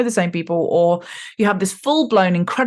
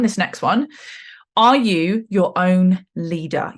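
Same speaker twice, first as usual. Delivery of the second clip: ordinary talk, nothing marked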